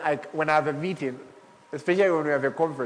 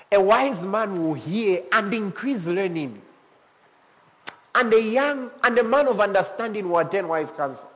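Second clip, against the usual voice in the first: scripture reading; 4 semitones higher than usual. Acted. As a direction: read the verse with weight. Reads scripture aloud.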